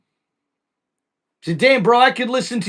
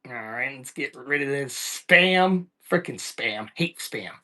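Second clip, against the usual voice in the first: muttering; 8 semitones lower, 8 dB quieter than usual. Real, not acted. Muttering while deleting spam.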